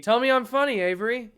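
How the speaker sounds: loud, high whiny insistence; mock demand. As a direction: demand praise like a spoiled kid.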